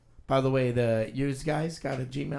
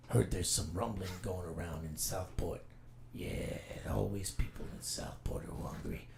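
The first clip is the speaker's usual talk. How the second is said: lowered, half-whispered Rorschach narration; 5 semitones lower, 8 dB quieter than usual. Acted. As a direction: whisper the night's plans like a grim vigilante. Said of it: "Rorschach, take two".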